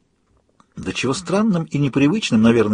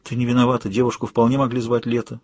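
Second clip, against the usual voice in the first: plain reading voice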